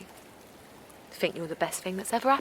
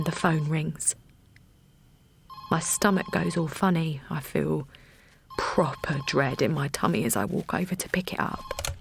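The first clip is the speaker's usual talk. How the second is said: ordinary delivery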